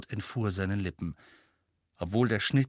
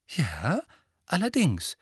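clear, audible voice; 7 semitones higher than usual. A narrator's reading. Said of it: "sondern mit klarer, vernehmlicher Stimme".

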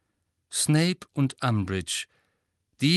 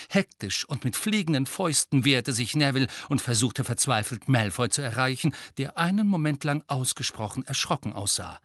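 ordinary delivery

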